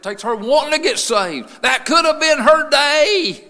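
full-force preaching. Real, not acted. Preacher lamenting the lady's lost day, full-force cry. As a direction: rise to a drawn-out, high shout.

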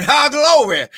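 normal talking voice

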